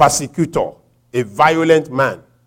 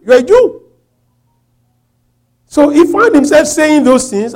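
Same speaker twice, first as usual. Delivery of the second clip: high, raised preacher's voice; forceful preaching; 12 semitones higher, 9 dB louder than usual. Real, not acted. Preacher explaining Paul's boasting to the congregation, forceful. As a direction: drive the point home to the congregation.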